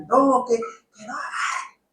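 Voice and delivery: slow, high, soft scold; mild mock scolding; scolding toddler. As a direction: warn the little one, drawn out. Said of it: "las palabras que uno le dice".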